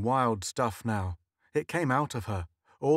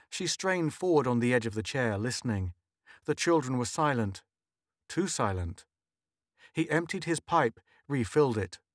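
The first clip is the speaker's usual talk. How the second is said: usual speaking voice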